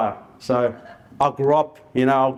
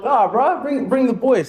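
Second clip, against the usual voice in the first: excited; 9 semitones higher, slower than usual. Retold or imitated. Excited about cousins arriving.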